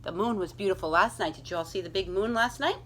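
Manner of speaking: ordinary delivery, nothing marked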